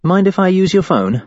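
studied offhand ask; carefully nonchalant. Acted. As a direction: ask it as if it's nothing. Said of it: "carefully nonchalant".

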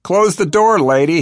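loud, high annoyed yell. A narrator's reading. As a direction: yell it to get her out. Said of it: "he yells".

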